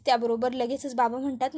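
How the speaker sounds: ordinary delivery, nothing marked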